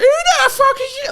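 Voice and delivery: high-pitched, loud retold outburst; went mad. Recalling blowing up at the producers, mad high yell. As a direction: act out losing it, yelling far above normal pitch. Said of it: "I just went mad".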